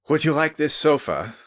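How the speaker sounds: breathless. Acted. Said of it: "he said breathlessly".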